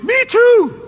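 high, loud, eager; lonely castaway thrilled to meet someone; excited castaway. Retold or imitated. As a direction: burst out with it, delighted. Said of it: "He goes".

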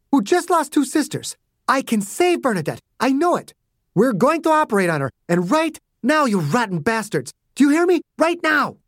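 high, rising furious voice; furious yell. A narrator's reading. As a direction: build to a demand. Margaret furious at agents.

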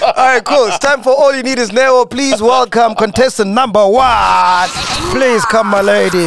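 upbeat game show welcome; flirty teasing dating host. Acted. Host introduces contestant.

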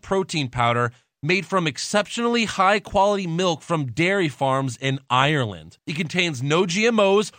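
loud, upbeat sponsor ad read. Acted. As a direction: sell it like a radio ad. Reads a sponsor ad.

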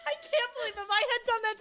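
loud, high, laughing gush; shrieking laughing delight. Real, not acted. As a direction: burst out with delighted love.

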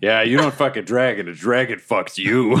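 normal talking voice

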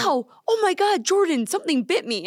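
imitating a sudden scream of pain; retold pained cry. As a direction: cry out as if something bit you. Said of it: "based off of, like, a scream".

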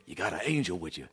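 low, soft celebrity impression; Danny Glover impression. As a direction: lightly imitate an older actor, low and quiet. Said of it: "Danny Glover's like".

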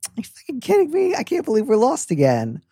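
high, exasperated and a bit tipsy; lightly exasperated, tipsy. Shown as a demonstration. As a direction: whine it like a tipsy friend lost again. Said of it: "lightly exasperated, slightly tipsy".

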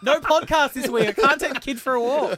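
high, loud whiny complaint; mock nagging wife. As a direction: whine it like a fed-up wife. Mocking wife's complaint.

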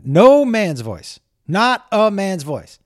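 "no" and "Not" drawn out high; emphatic insistence. Real, not acted. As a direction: lean hard on "no" and "Not". Insisting no man was recorded.

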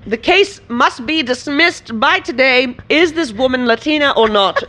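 imitating a stiff lawyer reading a ruling; mock official voice. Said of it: "It's like".

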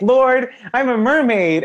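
high, slow, stunned cry to the Lord; dismayed realization. Pretend. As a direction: lament a fate you did not choose. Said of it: "I'd be like".

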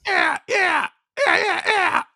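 high squeaky yeahs. Acted. Goofy intro bit.

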